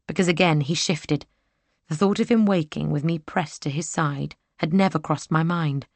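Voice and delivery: ordinary delivery, nothing marked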